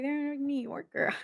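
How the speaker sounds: put-on playful New Yorker accent, quick and soft. Reader puzzled by the accent coming out, playful New York accent. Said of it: "I'm speaking like a New Yorker".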